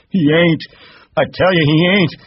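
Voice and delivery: very high, loud frantic denial; crying out. Acted. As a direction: shout it down in panic. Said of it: "And he cried".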